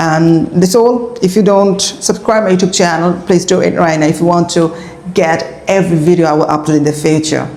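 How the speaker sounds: slow, careful English sign-off; slow outro. Acted. Teacher signing off and asking viewers to subscribe. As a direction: wind down the video slowly.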